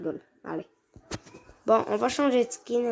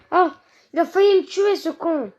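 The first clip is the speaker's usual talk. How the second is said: raging gamer; 8 semitones higher, 7 dB louder than usual. Real, not acted. Nearly killed in game, cursing.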